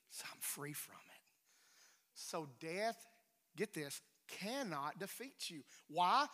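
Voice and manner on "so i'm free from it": whispered. Real, not acted. Quiet confession of freedom.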